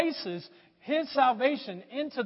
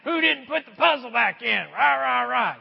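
mock angry grumble; 2 semitones higher, 3 dB louder than usual. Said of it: "people get mad".

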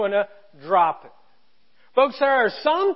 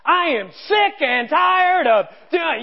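loud, higher, slow angry shout; mock angry outburst; 5 semitones higher, 4 dB louder, slower than usual. Person hangs up and yells at family in anger. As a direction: blow up again right after hanging up. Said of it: "then all of a sudden".

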